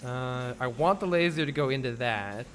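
ordinary talk

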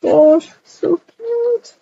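a drawn-out, high, tender "so cute"; cooing. Real, not acted. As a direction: coo at the little one, stretching it out. Cooing over the baby.

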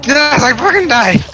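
high excited yell. Real, not acted. Reacting to his own death.

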